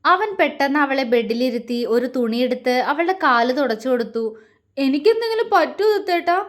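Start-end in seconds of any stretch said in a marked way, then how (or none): 4.8-6.4 higher, tearful frightened question; asking through welling tears; scared wife, voice pitched up; teary-eyed. A narrator's reading. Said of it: "അവൾ കണ്ണു നിറച്ചുകൊണ്ട് ചോദിച്ചു".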